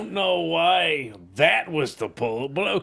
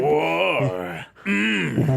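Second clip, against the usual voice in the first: gruff sexy voice; 3 dB louder than usual. Pretend. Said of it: "a gruff yet sexy voice shouting "War".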